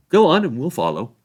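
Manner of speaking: high, loud, lofty rebuff; dismissive Frodo. A narrator's reading. Frodo refusing the sheriffs' orders, dismissive and loud. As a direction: brush the officials off grandly.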